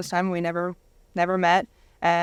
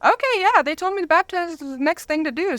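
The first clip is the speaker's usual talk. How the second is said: high, eager and a bit loud; eager quote; 10 semitones higher, 3 dB louder than usual. Retold or imitated. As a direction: play your younger self eagerly agreeing. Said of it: "And I'm like".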